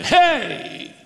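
high, sharp "Hey!"; sharp call. Shown as a demonstration. Demon interrupting Jesus's sermon, sharp high call. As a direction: snap it out to stop him. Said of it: "We could translate it".

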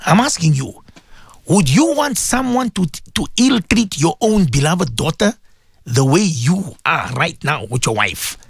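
usual speaking voice

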